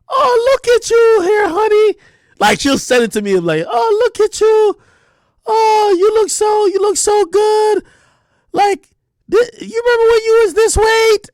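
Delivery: high, loud, slow sweet mom voice; doting mom. Retold or imitated. Mom sends old skinny photos to her son, high slow cooing. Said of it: "and be like".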